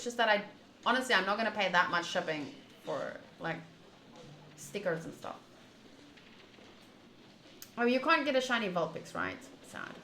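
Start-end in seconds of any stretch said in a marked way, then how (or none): none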